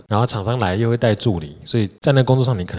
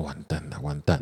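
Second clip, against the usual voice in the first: panicked inner thought; 5 semitones lower, 10 dB quieter than usual. Retold or imitated.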